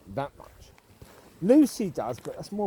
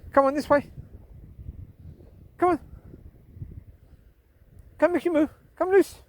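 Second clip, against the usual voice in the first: calling the dogs; 10 semitones higher than usual. Real, not acted. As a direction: coax the dogs along with calls.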